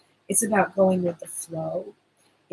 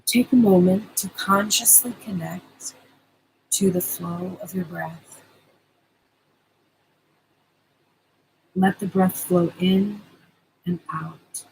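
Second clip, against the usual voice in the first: guided meditation. Acted. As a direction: soothe them into calm, unhurried. Leads the promised meditation.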